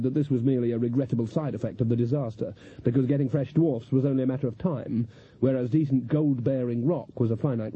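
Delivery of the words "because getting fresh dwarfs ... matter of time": ordinary delivery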